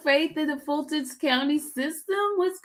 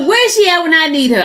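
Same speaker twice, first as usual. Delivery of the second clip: louder, higher, quicker grumble; loud complaint; 4 semitones higher, 10 dB louder, faster than usual. Real, not acted. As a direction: call it out in exasperation. Mock complaint to live audience.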